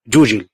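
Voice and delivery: جوجل with both ج as dj sounds; classical Arabic j. Shown as a demonstration. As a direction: say جوجل with the fuṣḥā dj, not g. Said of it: "إذا كتبها جيم هيبقى".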